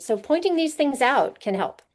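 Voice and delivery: ordinary talk, nothing marked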